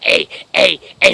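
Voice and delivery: short, loud barks; abrupt, punched repetitions; barked. Retold or imitated. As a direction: bark the letter straight at someone. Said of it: "I just barked it in her face".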